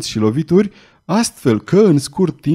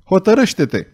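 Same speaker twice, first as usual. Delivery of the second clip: higher-pitched, restrained yell; howled command; 6 semitones higher than usual. A narrator's reading. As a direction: press her sharply to decide. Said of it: "urlă Belgoder". (poruncă strigată, reținută)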